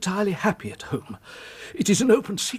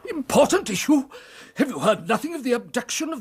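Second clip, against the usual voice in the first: indignant disbelief; 8 semitones higher than usual. Acted. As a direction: throw his words back in outrage. Indignant echo of Holmes's words.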